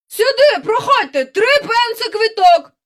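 high, loud barker's cry; ticket seller's call. A narrator's reading. Seller hawking threepenny tickets to the park crowd, loud calling. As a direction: call out to passers-by like a fairground seller. Fairground caller touting tickets.

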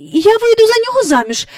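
fast, high, loud blurt; rushed pleading offer; desperate girl. Acted.

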